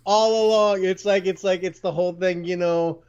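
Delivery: high, emotional voice; getting emotional. Real, not acted. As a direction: keep the voice up high, moved by the memory. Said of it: "I'm getting emotional".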